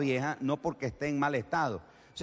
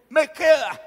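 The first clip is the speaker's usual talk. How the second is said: surprised delight; 11 semitones higher, 8 dB louder, slower than usual. Acted. Trying on old shirt, delighted.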